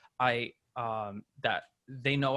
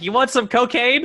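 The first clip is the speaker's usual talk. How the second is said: high, loud mock sales pitch; eager dealer, hammed-up; imitated street hawker; 10 semitones higher, 9 dB louder than usual.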